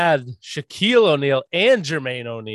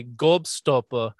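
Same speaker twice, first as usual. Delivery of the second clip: put-on slow English-accented "gobstoppa"; English cartoon-dog accent; 3 semitones lower, much slower than usual. Co-host quoting Kipper the English dog, slow imitated English accent. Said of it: "It was a dog from England".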